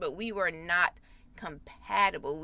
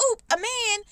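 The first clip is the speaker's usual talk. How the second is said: much higher, louder squeal of Oop, a man; mock swooning woman; 12 semitones higher, 5 dB louder than usual. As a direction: play the dazzled woman, squeaky and silly. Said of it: "I'm just gonna be like".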